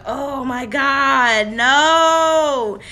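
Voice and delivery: loud, long-drawn mock wail; retold crying. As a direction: act out breaking down, stretching each word slowly. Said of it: "and I just cried".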